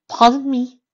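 high, startled; surprised lady voice. Acted. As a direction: caught off guard. Rarity surprised.

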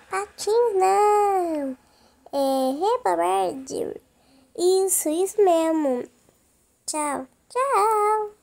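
voz de vovó aguda, bem lenta e mais alta; grandma voice. Pretend. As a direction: fala arrastada de vovó de brinquedo, aguda e alta. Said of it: "Nossa, que legal a voz dela". (vovó perguntando ao neto aonde ele vai, voz aguda e lenta)

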